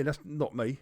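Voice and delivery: ordinary delivery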